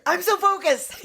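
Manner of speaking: high-pitched, over-eager exclamation; mock intense focus. Drugged officers telling each other, in hammed-up intensity. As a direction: imitate someone wildly keyed up.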